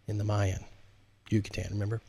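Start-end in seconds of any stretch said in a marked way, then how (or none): none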